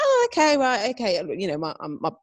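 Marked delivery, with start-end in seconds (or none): 0.0-1.2 high, loud 'oh', then settling; startled agreement. Retold or imitated. Rider replying to instructor's jump course, startled agreement. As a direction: take it on uncertainly, pitch jumping up.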